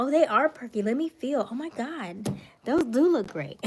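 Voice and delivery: put-on hamming up a girlfriend fawning over a friend; gushing girlfriend talk. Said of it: "we talk like this all the time".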